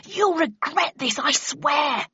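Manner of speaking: high, slow, drawn-out yell; yelled threat. A narrator's reading. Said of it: "yelled Shithouse".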